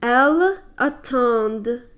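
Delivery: attendent with a strong, held D; French D sounded clearly; emphasis on the D. Shown as a demonstration. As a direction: lean on the D in attendent. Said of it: "Notice the emphasis on the, the D".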